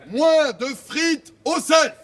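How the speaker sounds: loud, slowed crier's shout. Real, not acted. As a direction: call it across the yard.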